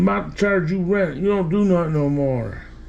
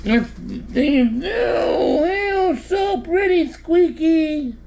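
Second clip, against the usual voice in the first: high squealing pet talk, much higher; 11 semitones higher, much slower than usual. Real, not acted. Owner gushing over the pretty cat.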